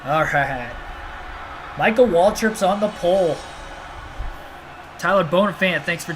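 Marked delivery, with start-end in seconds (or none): none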